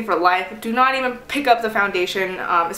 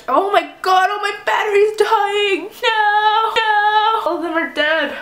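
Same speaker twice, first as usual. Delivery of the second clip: dismayed wail; 11 semitones higher, slower than usual. Real, not acted. Camera batteries die mid-video.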